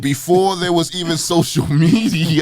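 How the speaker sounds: laughing it out, loud and high; bursting into laughter mid-sentence. Real, not acted. Laughing while making a joke.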